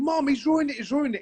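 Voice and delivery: put-on high whiny child's complaint; wailing schoolgirl. Imitating Bernadette complaining to her mum. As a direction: tattle like a little girl. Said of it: "Bernadette kept saying".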